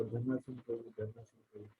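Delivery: faint and distant, like a bad call line; name called too faintly to hear; barely audible. Real, not acted. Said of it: "मेरे कुछ सुनाई नहीं दिया".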